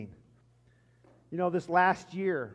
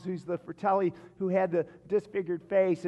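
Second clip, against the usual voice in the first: ordinary talk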